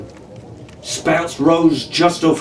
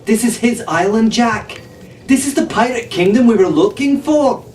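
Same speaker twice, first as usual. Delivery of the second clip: excited discovery; 9 semitones higher than usual. A narrator's reading.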